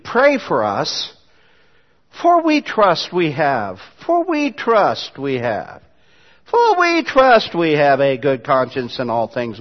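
slow, deliberate reading of the verse; measured scripture read. Shown as a demonstration. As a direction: lay each word out slowly. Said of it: "Verse eighteen".

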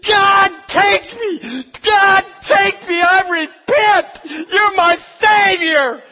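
very high, drawn-out full-volume scream; frantic wailing shout, stretched and piercingly high; panicked screaming. Acted. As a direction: scream a desperate plea to heaven. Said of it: "he's leaping and he's screaming".

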